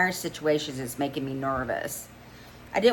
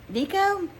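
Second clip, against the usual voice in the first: surprised. Acted. Server surprised at name.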